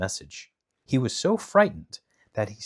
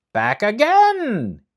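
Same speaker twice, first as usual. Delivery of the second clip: high, loud, slow astonishment; loud, slow surprised guard; surprised exclamation; 12 semitones higher, 9 dB louder, much slower than usual. Acted. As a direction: gasp it out in disbelief. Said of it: "he exclaimed in surprise".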